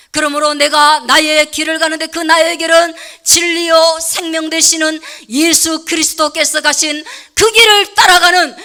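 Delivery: loud, ringing preacher's cry; full-force preaching. Acted. Pastor rallying the congregation, preaching at full force. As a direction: call the flock forward at full voice.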